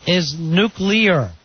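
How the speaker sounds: nuclear said slowly as nu-clee-ar, three syllables; correct nuclear. Shown as a demonstration. Reading the list's correct version, slow careful nu-clee-ar. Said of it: "the correct way to say it".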